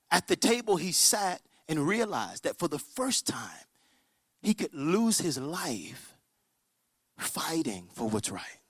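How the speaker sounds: slow, softened, weighty narration; solemn and drawn out, sinking low; grave storytelling. Retold or imitated. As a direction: stretch each phrase quietly.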